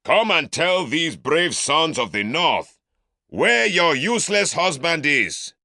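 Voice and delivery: mock the Southerner for the mob; scornful shout. Uncle calls Mwali out.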